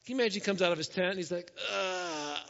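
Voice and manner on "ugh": drawn-out groan of exasperation; aggravated groan. Acted. Moses coming out of his tent to complaints, aggravated groan. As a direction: groan like a worn-down leader. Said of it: "Moses was very aggravated".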